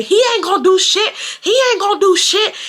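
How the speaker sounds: high-pitched mock scream; imitated screaming. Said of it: "He starts screaming".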